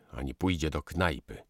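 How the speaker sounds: plain reading voice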